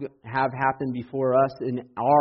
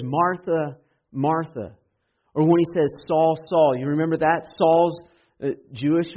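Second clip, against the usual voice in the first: high and loud preacher voice; raised pulpit delivery; impassioned preaching; 5 semitones higher, 6 dB louder than usual. Acted. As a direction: keep the fire going.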